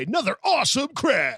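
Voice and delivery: high, hyped-up race commentator; hammed-up thrilled commentary; mock excited announcer. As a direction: cheer the wreck like an announcer. Mock sports announcer after a wreck.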